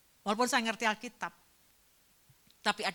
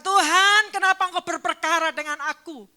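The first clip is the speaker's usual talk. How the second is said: loud, higher pleading cry; anguished cry; 7 semitones higher, 11 dB louder than usual. Retold or imitated. Job crying out to God, loud anguished plea. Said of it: "Dia bertanya".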